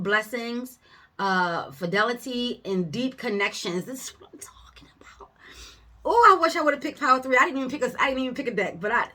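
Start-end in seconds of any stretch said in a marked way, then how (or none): 3.8-5.3 fast excited whisper. Real, not acted. Reader to viewers over the cards, excited whisper. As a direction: whisper it like a secret thrill.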